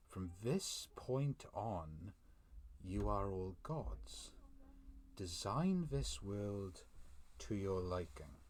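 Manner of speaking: slow, grand, every phrase drawn out; slow solemn decree. Acted. A goddess telling her followers they are gods, slow and solemn. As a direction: proclaim it slowly like a goddess granting power.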